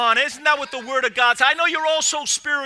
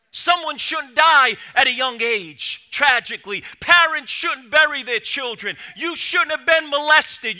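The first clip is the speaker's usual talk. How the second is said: raised, louder sermon voice building to a peak; fervent preaching; 4 semitones higher, 7 dB louder than usual. Acted. Preacher to congregation, driving the point home, loud and fervent. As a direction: preach it out full force to the congregation.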